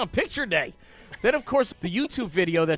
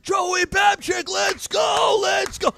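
much higher, slow drawn-out shout of the name; screaming cheer; 12 semitones higher, much slower than usual. Retold or imitated. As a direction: hype up the crowd for your son, shouting. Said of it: "I'm screaming".

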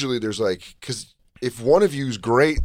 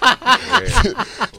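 high laugh; 10 semitones higher than usual. Real, not acted. Laughing at own joke.

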